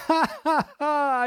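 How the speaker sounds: high, slow hammed-up laugh; a sarcastic "ha ha ha"; fake laugh. Mock laugh at clip.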